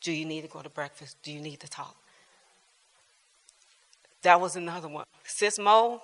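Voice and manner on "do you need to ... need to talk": gentle caring. Retold or imitated. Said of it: "she said".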